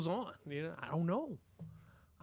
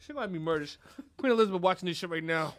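ordinary delivery

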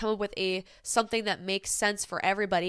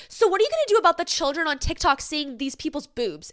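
mocking shouted question; 6 semitones higher, 4 dB louder than usual. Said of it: "the rest were just like".

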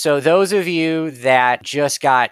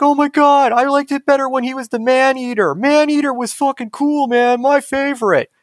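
much higher, gushing fan voice; freaking out fan; 14 semitones higher, 3 dB louder than usual. Acted. Fans in the tavern gushing about the Man-Eater, much higher voice. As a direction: gush like an obsessed fan. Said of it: "crazy people freaking out".

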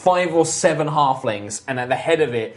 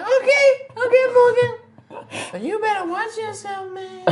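frog voice. Acted. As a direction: back off but keep a threat in it. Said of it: "He's like".

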